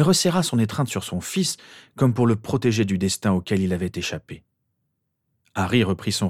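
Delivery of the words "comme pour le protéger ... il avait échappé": usual speaking voice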